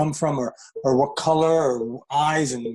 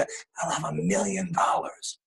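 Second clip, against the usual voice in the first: whispered doubt; 5 semitones lower, 7 dB quieter than usual. Retold or imitated. Passer-by eyeing buildings admits to himself, whispered. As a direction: drop to a hushed whisper.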